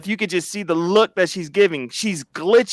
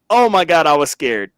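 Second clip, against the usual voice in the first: ordinary talk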